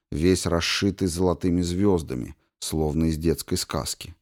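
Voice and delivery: ordinary delivery, nothing marked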